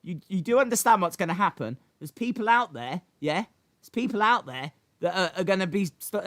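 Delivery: ordinary talk, nothing marked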